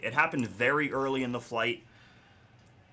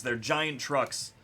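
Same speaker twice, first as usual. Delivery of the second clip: ordinary talk, nothing marked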